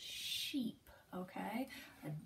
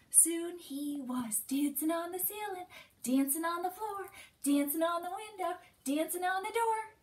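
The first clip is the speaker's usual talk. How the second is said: quicker rhyme chant; faster; 5 semitones higher than usual. Shown as a demonstration. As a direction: pick up speed on the rhyme. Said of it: "Now we're gonna get even faster".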